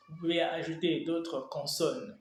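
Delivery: ordinary delivery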